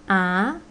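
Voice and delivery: "a" rising upward; second tone. Shown as a demonstration. Teacher demonstrating four tones, rising "a". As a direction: lift "a" like a question. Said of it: "four tones".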